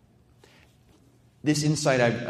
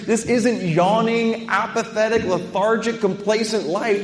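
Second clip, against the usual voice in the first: higher, louder, urgent preaching; fired-up preaching; 9 semitones higher, 7 dB louder than usual. Acted. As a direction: preach it all-in, full force. Preacher builds to full force.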